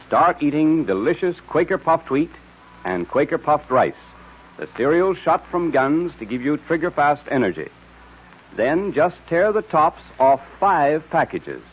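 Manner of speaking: ordinary talk, nothing marked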